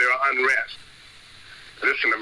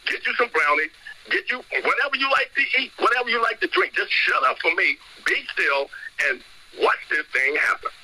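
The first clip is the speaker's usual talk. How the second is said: bossy, higher, no-nonsense telling-off; blunt neighborhood version; 7 semitones higher than usual. Retold or imitated. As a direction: tell them straight like on the block. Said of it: "You gotta tell them".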